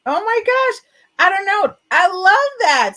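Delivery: moved to the edge of tears, gushing; gushing, deeply moved by the guest's words; emotional. Real, not acted. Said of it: "See, I'm getting emotional".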